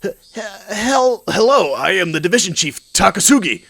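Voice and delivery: high, stammering introduction; flustered chief. Acted. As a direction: nervously stutter into a formal greeting. Flustered chief greets angry congressman.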